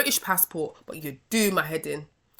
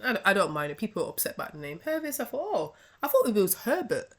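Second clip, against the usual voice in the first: normal talking voice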